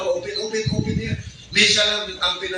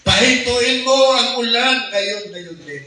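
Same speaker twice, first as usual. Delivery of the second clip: slow, raised solemn command, fading away at the end; the Lord commanding, slow and high; voice of God; 5 semitones higher, 4 dB louder than usual. Retold or imitated. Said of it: "Sabi pa sa akin ng banal ng boses".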